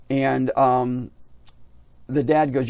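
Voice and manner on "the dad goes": ordinary talk, nothing marked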